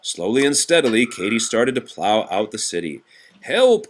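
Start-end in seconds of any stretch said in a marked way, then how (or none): 3.4-3.9 high urgent cry of help; calling for help. Acted. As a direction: call out like a frightened chief. Police chief calls for help.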